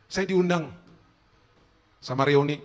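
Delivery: usual speaking voice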